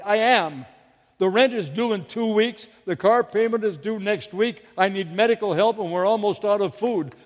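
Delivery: agitated reply. Retold or imitated. Panicked mother answering the inner voice, loud and agitated. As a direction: fire back the list of pressing worries, loudly. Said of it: "She replied".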